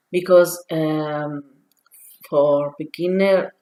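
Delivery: ordinary delivery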